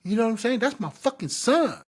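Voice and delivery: imitating a fed-up dad telling him to back off; angry warning. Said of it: "I'll be like".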